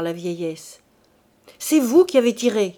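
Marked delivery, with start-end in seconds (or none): 1.6-2.8 loud, furious accusation; accusing shout. A narrator's reading. Madwoman accusing her two sons, furious shout. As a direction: cry out in dark fury. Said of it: "cria-t-elle".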